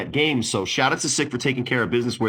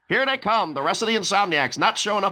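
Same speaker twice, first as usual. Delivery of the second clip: higher-pitched live broadcast call; announcer voice; 9 semitones higher than usual. Retold or imitated. Podcaster re-creating the broadcast booth, announcer voice. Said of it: "doing a regular announcer voice".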